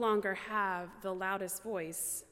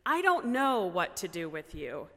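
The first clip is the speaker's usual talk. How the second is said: louder, lower, mock-exasperated; 4 semitones lower, 5 dB louder than usual. To a three-year-old nephew being extra silly, loudly exasperated. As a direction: throw it out firmly, half-joking at wit's end. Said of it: "still exasperated way of saying".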